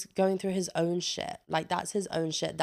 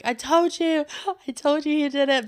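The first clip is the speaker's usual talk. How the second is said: high, loud, gloating I-told-you-so; triumphant outburst; 9 semitones higher, 5 dB louder than usual. Retold or imitated. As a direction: crow it like you called it all along.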